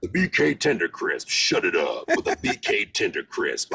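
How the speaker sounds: low, laid-back Tender Crisp read; Burger King ad voice. Shown as a demonstration. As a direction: do Lance's smooth BK voice. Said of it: "So I could do Lance".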